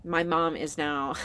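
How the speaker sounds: ordinary talk, nothing marked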